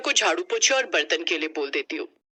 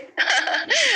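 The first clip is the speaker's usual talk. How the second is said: light laugh; 11 semitones higher than usual. Acted. Daughter-in-law laughs at joke.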